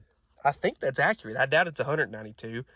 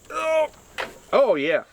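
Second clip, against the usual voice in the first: high, delighted; thrilled it turns; excited; 11 semitones higher, 3 dB louder, slower than usual. Real, not acted. Fan turns, engine not stuck.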